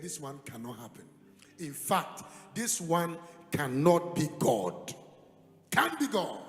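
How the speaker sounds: loud, slow rebuttal; emphatic refusal. Retold or imitated. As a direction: reject it forcefully. Refusing the voice from God.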